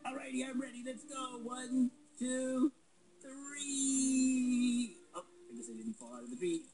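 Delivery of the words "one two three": high, loud shouted count; excited countdown. Acted. Countdown before jump.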